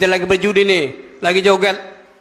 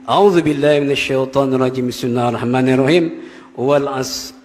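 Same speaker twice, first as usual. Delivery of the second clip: low, measured recitation; Quran recitation; 6 semitones lower than usual. Acted. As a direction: recite the verses devoutly. (membaca ayat dengan khidmat)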